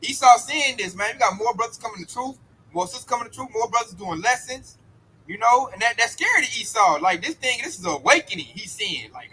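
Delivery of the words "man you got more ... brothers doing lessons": ordinary delivery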